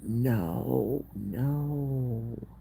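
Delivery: high, retold cry of dismay. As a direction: echo their stricken reaction, pitched well up. Vet staff react to sad news.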